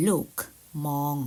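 ordinary talk, nothing marked

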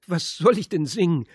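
bewildered and overwhelmed; distraught old woman. A narrator's reading. The confused old woman asks Radek what to sing, high and frantic.

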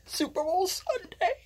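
squealing high cry of joy; falsetto cheer. Acted. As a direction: shriek it like a crazed fan. Hype chant, falsetto cry.

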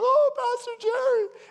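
very high, loud crying cry of his name; bawling. Retold or imitated. Woman bawling to the pastor on the phone, very high. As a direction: wail his name through tears. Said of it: "she's bawling on the phone".

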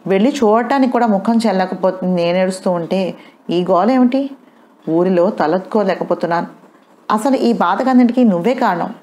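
usual speaking voice

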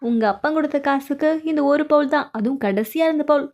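ordinary delivery

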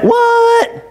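high, drawn-out incredulous what; hammed-up astonishment; mock disbelief. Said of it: "You should have went".

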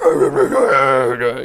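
loud imitated screamo scream; screamo growl. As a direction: growl out a wordless metal roar. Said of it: "another guy's just like".

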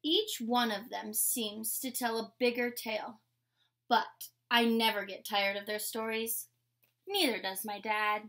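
usual speaking voice

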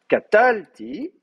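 "catalti" with a long, high TAL; stress on TAL. Shown as a demonstration. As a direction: lean hard on the middle syllable of "catalti". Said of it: "l'accent est sur catalti".

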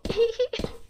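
high little put-on giggle; tittering, high-pitched mock giggle; acted giggle.

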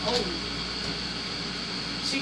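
ordinary talk, nothing marked

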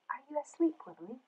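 soft, high question fading to very quiet; hushed checking. Acted. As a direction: ask gently, trailing almost to nothing. Checks on the sleeping pig.

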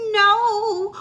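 high, loud desperate cry; Henry crying out to his father; cried out. Acted. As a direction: cry out to save the books. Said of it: "cried Henry".

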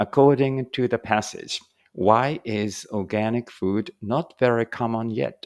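slow, careful English reading for learners; slow read-aloud. Shown as a demonstration. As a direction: read each word out slowly and clearly. Reads exam question slowly. (ゆっくり丁寧な英語の音読)

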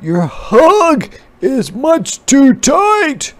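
put-on baby bug protesting the hug, squeaky; squeezed bug. A narrator's reading. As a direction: complain like a tiny squashed bug. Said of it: "said the bug".

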